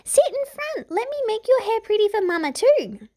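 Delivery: put-on high, childlike little-girl voice. A narrator's reading. Little sister coaxing her sibling, childlike voice. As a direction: play a bossy five-year-old. Five-year-old Branda voiced.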